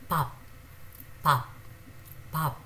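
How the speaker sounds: forced first p, soft final p in pap; pronunciation demo. Shown as a demonstration. Teacher modelling pap for learners, careful. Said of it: "slightly forced air ... a softer one".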